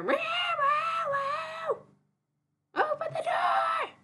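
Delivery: very high, loud meowing cat voice; high yowling house cat; whiny cat voice. Retold or imitated. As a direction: wail at the door like a demanding cat. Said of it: "I hear".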